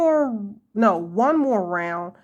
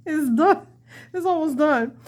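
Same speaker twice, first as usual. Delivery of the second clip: excited; 6 semitones higher than usual. Real, not acted. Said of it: "I think I was just really excited".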